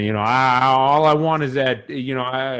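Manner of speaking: ordinary delivery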